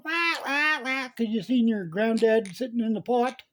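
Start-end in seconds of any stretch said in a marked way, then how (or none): 0.0-1.1 mock chicken wailing. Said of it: "You're going".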